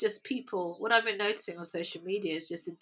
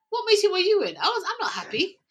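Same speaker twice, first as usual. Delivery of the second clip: higher, louder, incredulous; incredulous outburst; 11 semitones higher, 7 dB louder than usual. Retold or imitated. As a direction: exclaim in disbelief at the colleague. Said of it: "I was like".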